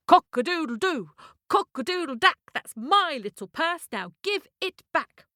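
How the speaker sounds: high rhythmic crow, slowing on the demand; crowing cockerel demand. Acted. Cockerel chasing the carriage for his purse, crowed demand. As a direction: chant it like an indignant cockerel, drawing out the ending.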